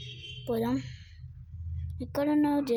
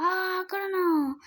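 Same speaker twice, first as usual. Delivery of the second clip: excited discovery; 7 semitones higher, 3 dB louder than usual. Real, not acted. Player finds his opponent hiding, excited discovery. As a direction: pitch leaps with sudden excitement. Spots the opponent mid-game.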